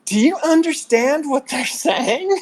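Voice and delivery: high-pitched, incredulous question; baffled aside. Retold or imitated. Friend from Michigan asking at the funeral, baffled high-pitched aside. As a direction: lean in with a high, puzzled question. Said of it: "leaned to me and said".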